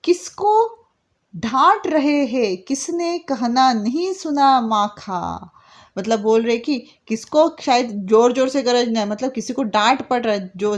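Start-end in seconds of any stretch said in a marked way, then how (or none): 0.0-5.5 poem recitation. Shown as a demonstration. Teacher reciting the poem to her students, slowly. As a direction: read the verse slowly and expressively.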